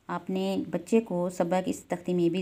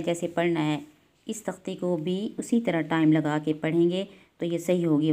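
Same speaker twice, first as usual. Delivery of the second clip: usual speaking voice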